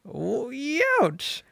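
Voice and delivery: high yelped ouch; a sudden squeaky ouch of pain; mock ouch. Woody yelps at the snake in his boot, high.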